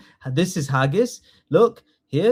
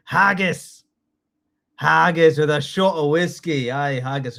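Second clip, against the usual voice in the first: hammed-up Scottish accent. Shown as a demonstration. Teacher performing Scottish words for the class, Scottish accent. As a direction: play it as a hearty Scot. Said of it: "Give me your best Scottish accent".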